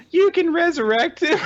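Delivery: put-on very high childish exclamation; hopeful little girl. Child hearing mom can mend the toy, amazed. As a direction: squeak it like an amazed kid. Acted child's reply to mother.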